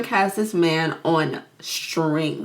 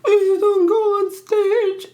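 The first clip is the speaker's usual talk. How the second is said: much higher, slow crying plea; mock tearful begging; 12 semitones higher, 3 dB louder, much slower than usual. Alice begging her husband not to go, slow crying wail. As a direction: wail it out like a sobbing wife.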